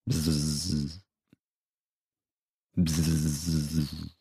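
soft, low buzzing hum; quiet droning bzzz of an insect; mosquito buzz. Acted. Narrator imitates mosquito buzz.

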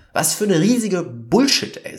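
real exasperation at the absurd news; exasperated outburst. Real, not acted.

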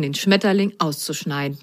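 usual speaking voice

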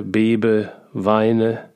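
plain reading voice

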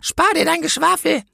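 high-pitched, impatient policewoman; interrupting policewoman. A narrator's reading. Policewoman cuts off the angel's speech, high and impatient. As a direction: cut in sharply in a high policewoman voice. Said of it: "unterbrach die Politesse".

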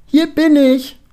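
high, loud, ringing holler; loud call. A narrator's reading. Penelope announcing herself across the square, loud call. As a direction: call out far too loudly, unpleasantly so. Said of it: "ruft sie dann so laut, fast so unangenehm".

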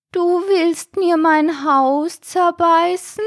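slow, very high snail voice; alarmed snail. A narrator's reading. Snail fears for its house, alarmed. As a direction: draw out a high, aghast question. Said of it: "Stielaugen der Schnecke wurden kugelrund".

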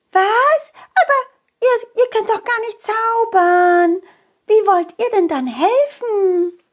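high, astonished tiny fairy; surprised little fairy. Acted. Little fairy doubting Colin can help without magic. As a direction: squeak out the disbelief.

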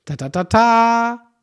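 loud "ta-ta-ta-taa" with long last note; drawn-out triumphant reveal jingle; mock fanfare. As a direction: announce the answer with a vocal fanfare. Mock fanfare before the answer.

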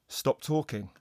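hushed stop order from the booth; hushed coach. Retold or imitated. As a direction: murmur it like a voice in an earpiece. Coach whispering in his earpiece.

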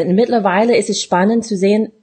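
normal talking voice

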